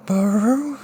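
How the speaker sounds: put-on girl calling his name; bell-like girl's voice. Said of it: "similar to the chime of a bell".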